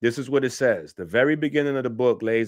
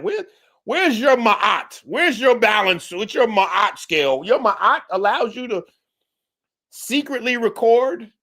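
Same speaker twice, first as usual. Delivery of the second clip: incredulous scolding; 8 semitones higher than usual. Real, not acted. A commentator scolds the priest for secret recording, incredulous and pitched up. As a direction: throw each question at him in outrage, pitched up.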